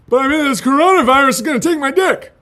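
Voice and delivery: loud, high mock complaint; joking outburst. Real, not acted. Streamer to viewers, a loud mock complaint. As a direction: blurt it out, half laughing at the bad luck.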